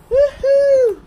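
high, loud happy whoop; excited whoop. Real, not acted. Vlogger whooping to viewers about her new soda maker, excited. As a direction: cheer it out like you just won.

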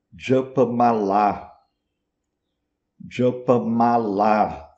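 "japamala" slowly, as juh-puh-maa-LAA; Sanskrit long A. Shown as a demonstration. Teacher demonstrates the Sanskrit word, final A of "japamala" held long. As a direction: hold both long As at the end of "japamala". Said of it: "em sânscrito".

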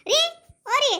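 loud, high summoning call; yelling for husband. Acted. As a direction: holler for someone to come inside. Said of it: "ಎದಕ್ಕೆ ಈ ಪರಿ ಚೀರಾಕತ್ತಿದಿ?".

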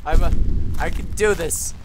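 ordinary delivery

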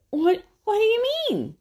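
high, loud startled question; Adam caught off guard; high bewildered Adam; puzzled. Acted. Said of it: "asked Adam".

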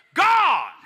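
high, loud, slow preaching shout; full-force preaching. Acted. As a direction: preach it out over the congregation.

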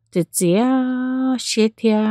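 plain reading voice

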